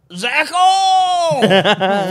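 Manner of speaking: put-on high, long drunken yell; heckler's shout. Drunk audience member calling out to the comic on stage, long shout. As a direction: holler the name from a crowd. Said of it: "from, like, the audience".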